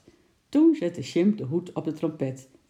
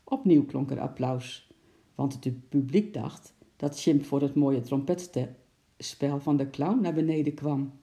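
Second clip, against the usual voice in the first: ordinary delivery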